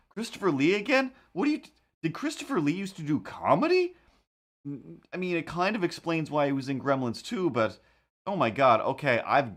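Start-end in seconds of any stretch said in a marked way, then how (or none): 0.1-3.9 higher, rising disbelief; can't believe it's him again; higher, baffled questions; incredulous. Real, not acted. Surprise at Lee listed.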